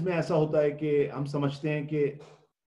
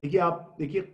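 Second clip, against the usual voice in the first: usual speaking voice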